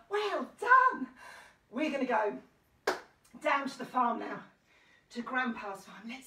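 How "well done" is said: big well-done to little ones; excited praise. Real, not acted. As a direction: praise them brightly, pitched right up. Praises children after the song.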